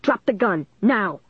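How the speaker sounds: restrained angry shout; narrator's moderate version of a police yell; angry command. A narrator's reading. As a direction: order it firmly, each phrase falling. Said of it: "he screamed angrily".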